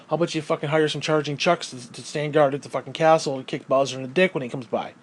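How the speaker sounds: ordinary delivery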